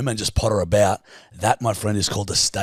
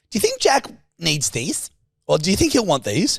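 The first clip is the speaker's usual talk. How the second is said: imitating Steph; 8 semitones higher than usual. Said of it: "she's just walked up to me and goes".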